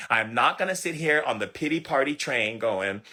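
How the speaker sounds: ordinary talk, nothing marked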